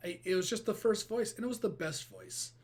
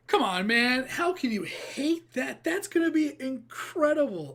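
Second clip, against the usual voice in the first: excited; 7 semitones higher, 8 dB louder than usual. Real, not acted. Hyped reaction after the trailer.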